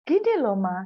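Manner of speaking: put-on a kid snapping at mom who won't get it; impatient child. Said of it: "Gitu kalau orang Surabaya bilang". (anak kesal membentak mama dengan nada tinggi)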